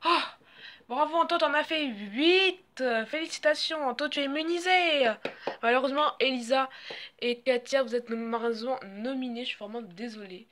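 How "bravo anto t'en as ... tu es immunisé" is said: excited congratulations. Acted. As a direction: congratulate with a loud, high lift. Host congratulates the immunised player. (félicitations enjouées)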